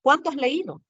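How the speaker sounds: "Cuánto" pitched high, then falling through "has leído"; admiring exclamation. Shown as a demonstration. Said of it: "puedo estar admirándome". ("Cuánto has leído" con admiración, tono alto que cae)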